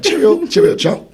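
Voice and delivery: high-pitched mock Cockney sign-off; hammed-up Cockney parody, pitched high; bad Cockney accent. Shown as a demonstration. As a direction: do a terrible, chirpy fake Cockney. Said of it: "Like, it's so bad".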